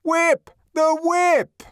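high, loud crowd chant; crowd cries. A narrator's reading. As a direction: shout it out from a clamouring mob. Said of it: "he heard cries of".

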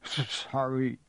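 choked tearful apology; sobbing. A narrator's reading. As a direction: stammer it through sobs. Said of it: "sobbed Hagrid".

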